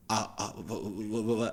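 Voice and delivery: soft, low, tongue-tied stammering; retold stammer. Boy at gunpoint trying to answer, imitated stammer. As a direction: stumble on the first sound, imitating speechless fright. Retelling being speechless at gunpoint.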